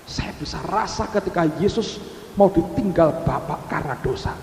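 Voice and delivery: usual speaking voice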